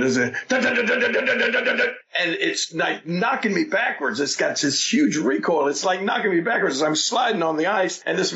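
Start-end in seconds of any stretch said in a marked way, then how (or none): 0.6-1.9 high staccato dun dun gun sounds; machine gun noise. Retold or imitated. Imitating the T-shirt gun firing, staccato gun noises. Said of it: "like I'm shooting a real machine gun".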